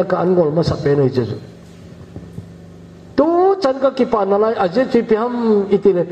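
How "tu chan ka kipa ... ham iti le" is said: usual speaking voice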